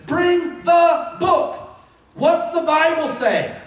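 high, slow ringing cry of bring the book; preaching call. Acted. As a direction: stretch it out like a rallying cry.

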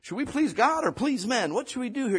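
a hint of Paul asking the question; quoting Paul. Preacher voicing Paul asking the church. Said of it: "where he says".

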